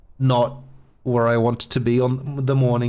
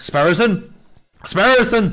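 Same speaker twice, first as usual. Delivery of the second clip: rising, louder, higher calls of the name; alarmed calling; 9 semitones higher, 5 dB louder, slower than usual. Acted. Lawyer calling his collapsed partner, rising to a high loud cry. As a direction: call out to him, growing alarmed.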